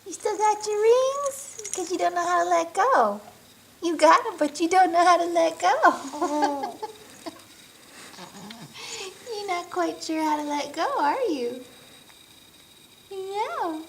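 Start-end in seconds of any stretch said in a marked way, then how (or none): 0.1-10.7 excited teasing. Real, not acted. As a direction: tease him loudly and quickly. Teasing baby loudly.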